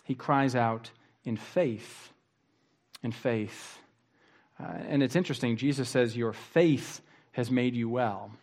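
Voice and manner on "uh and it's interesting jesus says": ordinary talk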